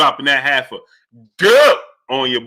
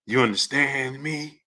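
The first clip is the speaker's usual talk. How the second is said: slow, softer mob-boss mimicry; cotton-mouthed Godfather imitation; 7 dB quieter, slower than usual. Host mocking a clip, cotton-mouthed Godfather voice. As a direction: drag it out through a mouthful of cotton. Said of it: "he had cotton balls in his mouth".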